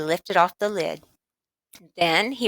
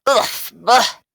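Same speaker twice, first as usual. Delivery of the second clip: louder disgusted ugh; sputtered ugh; 5 semitones higher, 6 dB louder than usual. Acted. Boy tasting horrible tonic, sputtering in disgust. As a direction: spit out a gross-tasting gasp. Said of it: "John sputtered".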